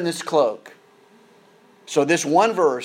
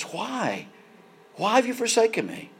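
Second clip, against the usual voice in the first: a mild, higher anguished cry on "Why have you forsaken me"; cry of Jesus; 6 semitones higher, 6 dB quieter than usual. Retold or imitated. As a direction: let "Why" break off softly, then lift it into a pleading cry. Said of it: "Can you imagine the cry of Jesus".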